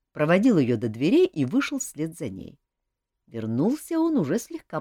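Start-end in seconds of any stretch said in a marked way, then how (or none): none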